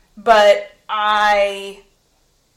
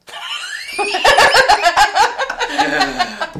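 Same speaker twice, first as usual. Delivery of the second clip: high shrieking laugh; loud burst of laughter; 11 semitones higher, 5 dB louder than usual. Real, not acted. Laughs at her own "No.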